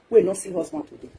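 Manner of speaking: ordinary delivery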